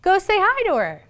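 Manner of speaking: high, insistent parent prodding; parent urging. Retold or imitated. Mom sends child to greet a friend, mock-urging. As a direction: lightly mimic a pushy parent. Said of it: "do what my father said to me".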